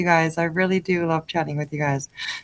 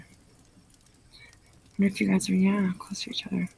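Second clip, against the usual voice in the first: hushed whispered repeat, much softer; 6 dB quieter than usual. Pretend. Streamer whispers the question again to her chat, hushed.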